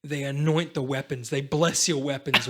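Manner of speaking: usual speaking voice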